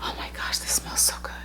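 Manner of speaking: unvoiced awed whisper. Real, not acted. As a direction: breathe it out in delight. Smelling perfume, hushed awe.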